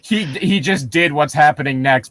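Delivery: ordinary delivery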